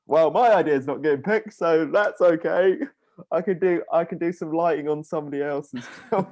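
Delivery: retold putting on a brave face; mock-cheerful resignation. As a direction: brightly make do, hammed-up. Retelling resigned thoughts after pitch.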